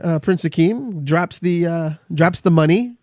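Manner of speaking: usual speaking voice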